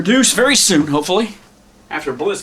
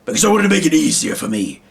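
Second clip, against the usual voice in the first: raised head-alien puppet voice; Slagnar's alien voice; 5 semitones higher than usual. Shown as a demonstration. Puppeteer showing how his head alien talks, higher character voice. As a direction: pitch it up as the alien leader. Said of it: "Slagnar talks, he just talks like this".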